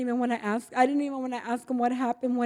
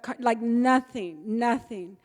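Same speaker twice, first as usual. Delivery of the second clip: ordinary talk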